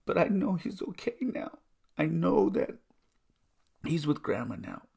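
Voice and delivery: high, emotional relief; high voice brimming with happy tears; choked up. Real, not acted. Son relieved his father is with Grandma, choked up.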